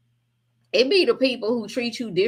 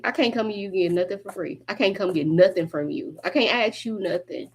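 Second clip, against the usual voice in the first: ordinary talk, nothing marked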